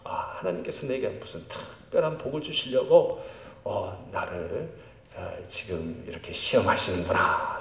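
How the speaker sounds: soft, low inner voice; hushed, musing realisation; thinking to oneself. Acted. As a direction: reflect quietly, as if to oneself. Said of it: "이렇게 생각하십시오".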